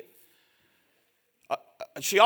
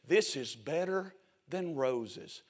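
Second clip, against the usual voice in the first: soft wife voice; 10 semitones lower, 13 dB quieter, slower than usual. Retold or imitated. Wife's delighted reply, retold.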